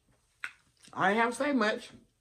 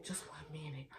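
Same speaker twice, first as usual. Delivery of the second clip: breathy hush; 4 semitones lower, 19 dB quieter than usual. Retold or imitated. Caller whispering, very quiet.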